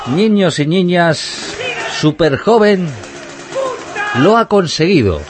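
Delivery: booming showman announcement; ringmaster. Acted. Grand announcement to the audience.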